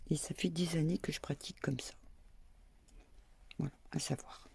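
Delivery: confiding whisper; whispered confession. Real, not acted. Confiding her non-professional method to viewers in a whisper. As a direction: lean in and whisper it like a secret.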